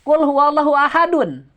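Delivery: ahadun read through with the nun sounded; connected reading. Shown as a demonstration. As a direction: read ahadun joined, no stop.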